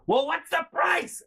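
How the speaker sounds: high, slow imitated yelled demand; mom screaming. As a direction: screech the question as the mother. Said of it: "you won't stop screaming at me".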